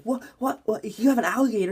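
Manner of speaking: high, startled mock disbelief; imitated freaking out. Relatives react to the alligator in his hand, high-pitched mock freak-out. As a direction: squeal it like friends freaking out. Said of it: "they're, like, freaking out".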